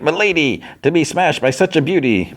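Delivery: high, courtly little bug voice; gallant bug. Retold or imitated. Squashed bug flatters Fiona who landed on him, gallant high voice. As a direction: play a flattering gentleman bug.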